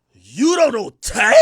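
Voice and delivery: loud, slow mock astonishment; incredulous date. Date shocked at his ignorance, incredulous question. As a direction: imitate her stunned question, drawn out. Imitating her disbelief.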